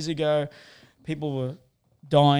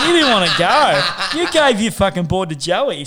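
a loud, higher retort laughed through; laughing; 4 semitones higher, 9 dB louder than usual. Real, not acted. Teasing the host who wanted to come, laughing loudly. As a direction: laugh the words back at him.

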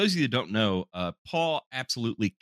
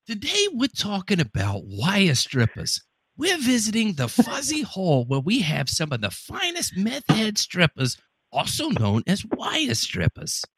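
higher, hammed-up presenter voice, slowing at the end; mock TV show intro; 9 semitones higher than usual. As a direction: play a cheesy show host introducing the topic. Parody product show intro.

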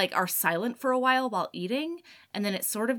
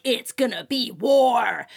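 dramatic war declaration; 2 semitones higher, 7 dB louder, slower than usual. Retold or imitated.

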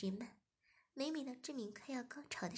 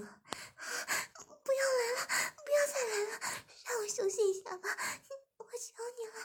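high tearful begging; struggling plea; 13 semitones higher, 6 dB louder than usual. A narrator's reading. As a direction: beg desperately while struggling. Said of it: "边挣扎着边说".

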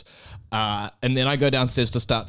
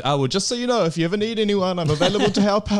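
put-on the unapologetic girl; 10 semitones higher, 4 dB louder, faster than usual. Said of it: "she was like".

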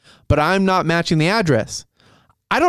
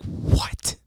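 soft, voiceless mock disbelief; stunned whisper; 7 dB quieter than usual. Customer hearing of a huge install fee, stunned whisper. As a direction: whisper it as if floored.

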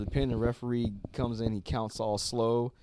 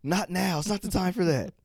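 higher, louder mock-stern voice; pitched-up mock telling-off: not now; mock scolding; 10 semitones higher, 4 dB louder than usual. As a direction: scold him like a fed-up friend, pitched up. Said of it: "Rio's like".

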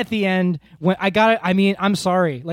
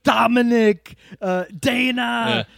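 put-on higher, shouted name in an Arnold accent; Schwarzenegger impression; 5 semitones higher, slower than usual. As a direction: yell it like Arnold calling his kid. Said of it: "he's forever screaming the names of his children".

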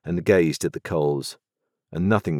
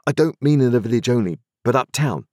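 higher, quicker eager youth; young Pip, eager; 6 semitones higher, 3 dB louder, faster than usual. A narrator's reading. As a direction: hurry to explain.

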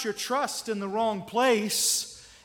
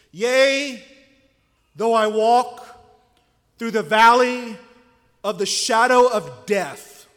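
psalm recited, swelling louder at the valley of the shadow; solemn scripture recital; 5 dB louder than usual. Acted. Preacher reciting the psalm to the congregation, building solemnly.

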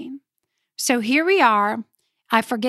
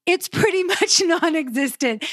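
laughing; 5 semitones higher than usual. Real, not acted. Repeats line, laughing.

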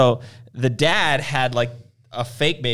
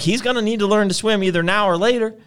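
raised, louder retold declaration; mock insistence; 8 semitones higher, 5 dB louder than usual. Husband holding baby over water, mock defiant. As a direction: imitate a stubborn husband, louder and higher.

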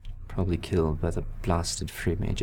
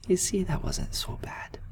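high, whispered, tender coaxing; 11 semitones higher than usual. Acted. Paladin cheering up the girl, high whisper. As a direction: coax a small child.

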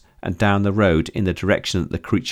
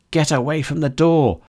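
urgent warning; 8 semitones higher than usual. A narrator's reading. May warns Kara urgently.

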